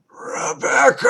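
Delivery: very high, loud distorted shout; inhuman shout. A narrator's reading. Creature calling Rebecca from the stairs, shouting. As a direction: scream her name through the door. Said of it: "came a shout".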